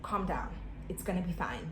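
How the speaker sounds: low, soft imitated reassurance; calming nurse. Nurse telling a panicking patient to relax, calming. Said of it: "the lady's like".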